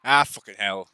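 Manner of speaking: rough, hammed-up British accent; mock British accent. Streamer reacts to friend's backstory, mock British accent. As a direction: exclaim in a put-on British accent. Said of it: "What was that British accent".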